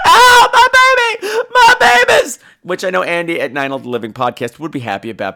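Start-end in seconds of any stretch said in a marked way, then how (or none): 0.1-2.4 very high, loud hammed-up wail; mock anguished yell. Woman finding her fallen children, hammed-up shriek. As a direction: scream it like a melodramatic mother. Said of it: "when people yell that".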